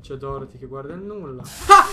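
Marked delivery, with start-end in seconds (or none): 1.4-1.8 startled scream. Real, not acted. Jump scare.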